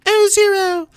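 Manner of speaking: very high, hammed-up falsetto; a melodramatic dying heroine in falsetto; dying Iris. Gamer acting out Iris dying to Zero, mock falsetto. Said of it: "the scene where Iris is dying".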